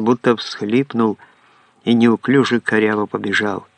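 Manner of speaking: plain reading voice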